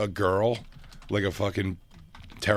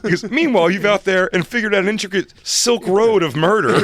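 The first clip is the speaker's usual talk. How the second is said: high, loud indignant voice; exaggerated outrage; indignant husband; 10 semitones higher, 7 dB louder than usual.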